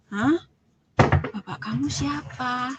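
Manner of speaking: retold disbelief, stretching the question; baffled. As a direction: act out someone taken aback. Woman baffled by the claim.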